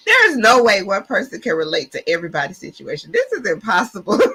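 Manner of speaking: loud laughing disbelief. Real, not acted. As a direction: laugh it out, overwhelmed. Laughing, overwhelmed.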